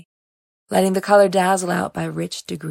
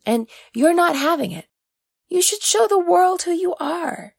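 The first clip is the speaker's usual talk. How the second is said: put-on much higher, earnest coaxing young man; Oriaku, eager and pushing; 5 semitones higher than usual. Oriaku urging Uma to live openly, eager high voice. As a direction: urge her to show herself, voice up high.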